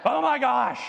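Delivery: loud, high "oh my gosh" of a fed-up parent; a pastor telling his flock about his son, exasperated outburst; exasperated groan. Real, not acted. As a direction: let the frustration burst out. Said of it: "more patience than your pastor does".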